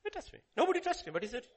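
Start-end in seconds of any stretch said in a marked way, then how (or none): 0.0-0.4 high mock question. Preacher echoes Jesus high.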